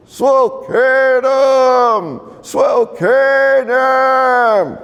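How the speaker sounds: high, drawn-out calling elder. Retold or imitated. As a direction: call the name slowly across the hall. Elder calls out from front row.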